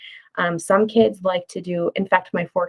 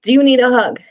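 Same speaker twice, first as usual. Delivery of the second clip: tender whisper; 4 semitones higher, 6 dB louder than usual. Shown as a demonstration. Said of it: "tender offer, unnamed".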